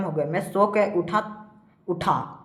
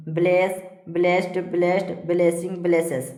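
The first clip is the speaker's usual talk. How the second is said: evenly spaced word-by-word list; steady classroom drill; verb drill recitation. Shown as a demonstration. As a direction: recite each form separately for pupils to memorise. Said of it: "इस प्रकार से सारा को पढ़ते जाना है".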